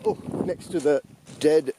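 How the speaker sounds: talking over roaring wind; windy clifftop. Real, not acted. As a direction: speak up against gusts of wind. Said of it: "can't hear me over the wind noise now".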